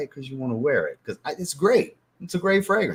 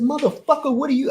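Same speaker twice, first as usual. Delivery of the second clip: high, loud, indignant retelling; worked-up, high-pitched mock outrage; retold angry outburst; 4 dB louder than usual. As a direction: reenact cussing out a stranger, pitch jumping up. Retelling his outburst at intruders.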